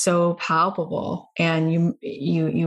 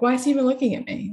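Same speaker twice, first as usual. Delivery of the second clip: fast, higher, anxious self-doubt; self-doubting inner voice; 6 semitones higher, much faster than usual. Retold or imitated. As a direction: rattle off the insecure thought. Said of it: "felt such shame".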